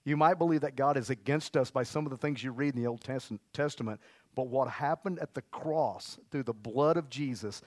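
ordinary talk

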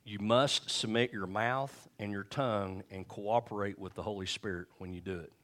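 ordinary talk, nothing marked

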